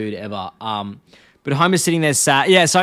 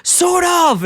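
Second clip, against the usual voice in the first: much higher, louder acted cry; self-confident shout; 6 dB louder than usual. Homer obeying Herb's pep talk, acted confident yell. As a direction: belt it out with mock confidence. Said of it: "answer with self-confidence".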